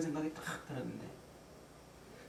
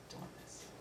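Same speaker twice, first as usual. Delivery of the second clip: tiny, voiceless afterthought; whispered echo; 22 dB quieter, faster than usual. Real, not acted. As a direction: shrink the words down to a whisper. Hushed echo of shrinking feeling. (기어들어가듯 속삭이며 되뇌기)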